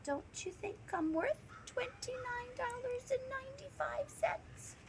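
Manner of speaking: put-on soft, high, small child voice; timid, pleading hush; quiet little girl. A narrator's reading. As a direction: ask dad softly in a tiny high voice. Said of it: "Taya quietly said".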